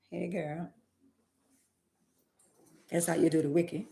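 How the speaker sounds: ordinary talk, nothing marked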